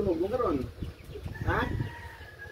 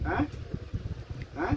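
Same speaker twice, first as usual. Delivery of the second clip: ordinary talk, nothing marked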